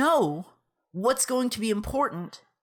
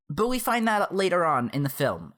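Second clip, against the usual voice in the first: ordinary talk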